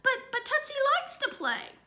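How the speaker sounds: put-on high, stammering small boy; child protesting to his mother; little boy pleading.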